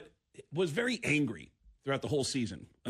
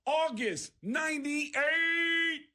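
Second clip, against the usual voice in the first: high, slow shouted movie plug; hammed-up high announcer yell; retold yelling plug; 11 semitones higher, slower than usual. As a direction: bellow the plug across the club. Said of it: "starts yelling out a plug".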